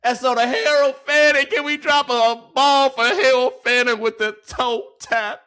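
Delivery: loud, high celebratory call; hyped shout-out. Real, not acted. Hyping the catch.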